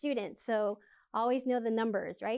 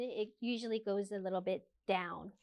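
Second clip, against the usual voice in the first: normal talking voice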